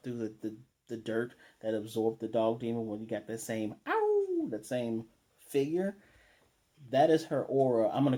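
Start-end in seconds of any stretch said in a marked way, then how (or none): 3.9-4.5 high-pitched "ow" howl; imitated howl. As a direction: let out a long, high mock howl. Imitates noise while describing figure.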